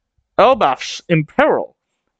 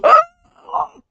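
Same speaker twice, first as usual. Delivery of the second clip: very high excited squeal; Roto's squeal. Retold or imitated. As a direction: shriek with sudden delight. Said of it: "And Roto goes".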